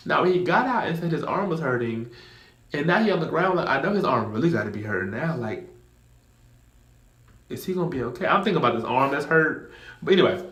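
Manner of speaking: ordinary talk, nothing marked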